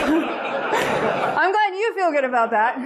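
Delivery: slow, laughing mock thanks; sarcastic laugh. Laughing sarcastically at the boss who claimed the layoff was a favor.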